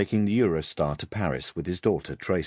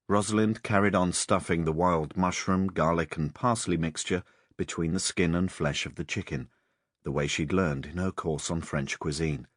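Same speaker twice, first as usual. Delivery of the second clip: usual speaking voice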